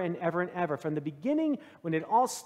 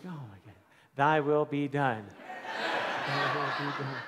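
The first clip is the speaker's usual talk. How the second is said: laughing in disbelief; 5 semitones lower than usual. Retold or imitated. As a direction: laugh at yourself, deflated. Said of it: "I was like".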